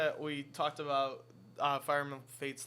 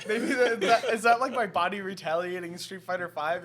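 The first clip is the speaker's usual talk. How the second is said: laughing; 5 semitones higher, 10 dB louder than usual. Real, not acted. Laughing at own hiccups.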